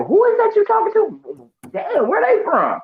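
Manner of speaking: very high, slow mock voice; family members puzzled. Family puzzled by Florida talk, high mock voice. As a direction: squeal it like baffled relatives. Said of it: "my family members would be like".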